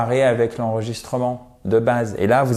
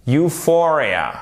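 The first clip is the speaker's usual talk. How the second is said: slow Euphoria, higher on FOR; stress on F syllable; 4 semitones higher, much slower than usual. Shown as a demonstration. Teacher repeating after explaining the accent, stress on F syllable. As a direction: lean on the FOR of Euphoria. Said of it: "un accent tonique sur le F".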